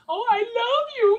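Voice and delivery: put-on high, loud, drawn-out baby talk; gushing affection. Dad mock-gushing over his daughter at bedtime, squealing. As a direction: smother the kid in squealed love.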